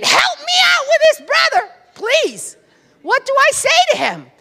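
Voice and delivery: high, loud pleading cry; desperate cry to God. Retold or imitated. Preacher crying out to God for help, loud plea. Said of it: "screaming silently to God".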